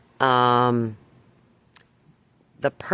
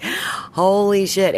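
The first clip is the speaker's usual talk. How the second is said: drawn-out, higher, slow holy shit; stunned disbelief; 6 semitones higher, 3 dB louder, much slower than usual. Retold or imitated. Recalling the neighbor's accusation, a slow stunned exclamation. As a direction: let it sink in slowly, aghast. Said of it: "I'm like".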